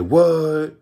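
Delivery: mock surprise. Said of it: "I said".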